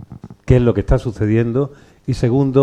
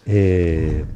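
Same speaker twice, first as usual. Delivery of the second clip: ordinary delivery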